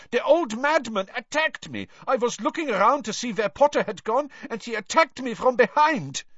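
put-on high, accented, insistent complaint; aggrieved Krum. A narrator's reading. Injured Krum telling Dumbledore of the attack, aggrieved.

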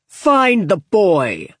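slow, loud barked command; roared order. Acted. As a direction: roar the order at your man. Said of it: "roared Corda".

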